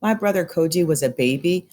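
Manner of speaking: ordinary delivery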